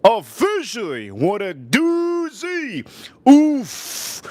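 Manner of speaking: high, drawn-out mock amazement; hammed-up quote. Streamer reads out a viewer's super chat, hamming it up. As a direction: play up the viewer's message, stretched and high.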